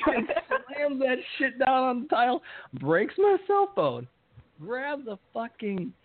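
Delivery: worked-up storytelling; excited. Real, not acted. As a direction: stretch it out, wound up. Excited retelling of rampage.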